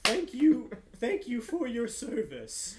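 high, loud, slow effusive gratitude; a flustered clerk thanking his rescuers; gushing thanks. Acted. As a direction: overflow with thanks.

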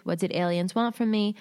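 usual speaking voice

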